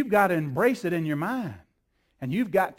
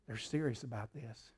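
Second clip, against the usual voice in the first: soft, low and hushed; hushed awe; 9 semitones lower, 15 dB quieter than usual. Retold or imitated. Devil taking note of serious prayer, hushed. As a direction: murmur it in awe. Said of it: "quiet take vs loud".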